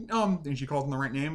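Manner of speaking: ordinary talk, nothing marked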